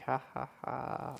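slow, soft villain laugh; lightly voiced evil whaler chuckle; mustache twirling villain. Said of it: "the mustache twirling, you know, whalers".

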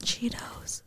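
breathy close-mic whisper; ASMR whisper. Shown as a demonstration. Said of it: "Should I do real A S M R?".

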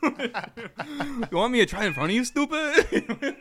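high, slow question through laughter; mock disbelief. Customer asked to taste coffee, mock disbelief. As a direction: draw it out high and incredulous. Acted retort to barista.

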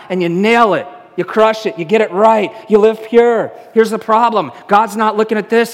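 loud, driving sermon voice; a pastor pressing the point home; impassioned preaching. Acted. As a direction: preach it hard to the room. Preaching at full force.

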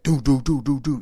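rhythmic knocking noise; quick run of echoing knocks; imitated door knocking. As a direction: sound out knocks on a metal door. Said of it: "So it was like".

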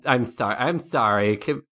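ordinary talk

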